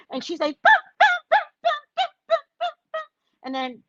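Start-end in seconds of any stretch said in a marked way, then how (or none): 0.6-3.0 very high staccato notes, fading; mock piano sounds; imitated piano playing. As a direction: plink away like an oblivious child. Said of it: "she's playing the piano".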